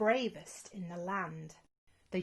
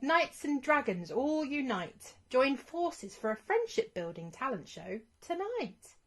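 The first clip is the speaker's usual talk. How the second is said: higher, louder herald-style proclamation; announcement; 5 semitones higher, 3 dB louder than usual. Acted. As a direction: proclaim it like a town crier. Banner message announced.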